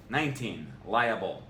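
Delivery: ordinary delivery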